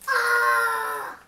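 sudden loud cry of alarm; shout. Acted. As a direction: yell it out, startled. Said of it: "The froggy said".

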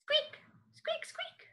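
very high tiny squeaks; very high short mouse squeaks. Shown as a demonstration. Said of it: "What about this one?".

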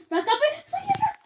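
high urgent cries to run; urgent warning. Real, not acted. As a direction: warn your friend to flee.

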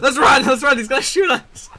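high, loud panicked yelling; excited panic. Real, not acted. Player fleeing a shooter with a teammate, panicked yell. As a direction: urge a friend to flee, high and loud.

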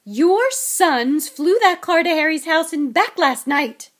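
loud, slow, hammered accusation; enraged wife, high and forceful; furious shout. A narrator's reading. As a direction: yell each word at the husband. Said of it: "shouted Missus Weasley".

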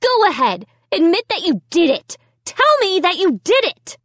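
high-pitched insistent accusation; worked-up, high-voiced challenge; frantic demand. A narrator's reading. Confronts the dummy to confess.

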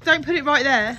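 loud high alarmed protest; frightened to have it close; panicked. Real, not acted. Afraid of the spider.

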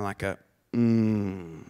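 low appreciative hum; a deep satisfied mm; guttural grunt. Shown as a demonstration. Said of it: "one of these guttural grunts".

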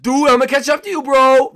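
loud, high-pitched mock rival; rival racer taunt. Rival player hollers after the leader, high loud taunt. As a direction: play the other racer yelling a challenge.

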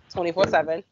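ordinary delivery

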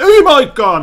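sudden high shriek of fright; real jump-scare scream; genuinely startled shriek. Real, not acted. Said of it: "mä säikähdin".

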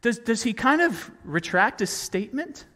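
ordinary delivery

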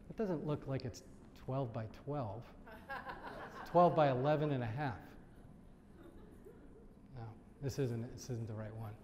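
quiet, low, doubtful mock murmur; imitated owl buyer. The owl walks in and checks the numbers, quiet low mock doubt. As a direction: softly play a fussy detail-oriented buyer, measuring aloud. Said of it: "The owl walks in and goes".

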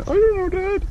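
high, lightly voiced child; kid voice. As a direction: imitate a clueless kid. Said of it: "kids retold tangling line".

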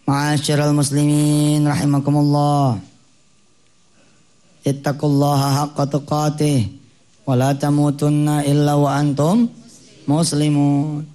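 slow, even-toned Friday preacher; measured Arabic khutbah recitation, imitated; khotib's sermon formula. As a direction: recite it like a khotib opening the khutbah, drawn-out endings. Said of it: "diingetin terus sama kepala khotib".

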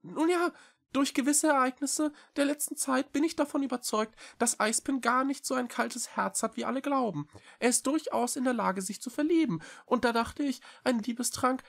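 very high, quick small-creature voice; little cat Echo, earnest. A narrator's reading. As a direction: plead eagerly in a high, fast voice. Cat explains plan. (hohe, eilige Katerstimme)

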